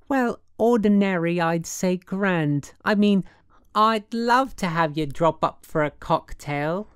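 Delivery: high, insistent woman's chatter; affected city woman, apologetic. A narrator's reading. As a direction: pile up excuses with social airs. Woman on phone, making excuses.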